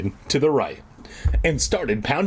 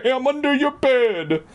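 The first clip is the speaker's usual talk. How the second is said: very high joke horse voice with a whinny; neigh, then talk like a horse; silly high-pitched talking horse; 13 semitones higher than usual. Pretend. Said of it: "It wasn't like a horse breathing".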